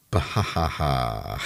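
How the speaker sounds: slow, low "bahahaha"; mock laugh. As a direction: draw out a low, put-on belly laugh. Imitated laugh after naming the film.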